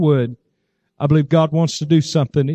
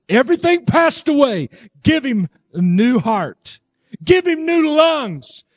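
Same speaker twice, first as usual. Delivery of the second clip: inspired prayer; 10 semitones higher than usual. Retold or imitated. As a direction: call out each petition high, like a revival preacher praying. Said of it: "really feeling just inspired to pray that way".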